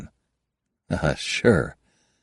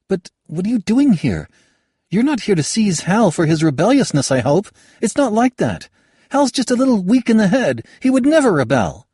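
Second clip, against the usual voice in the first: higher voiced young woman, pressing her point; young woman arguing; 14 semitones higher, 6 dB louder than usual. Kayada lectures Halbert on the nobles, higher and firmer. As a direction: lay out the case sharply, rising as she goes.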